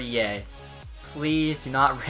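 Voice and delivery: usual speaking voice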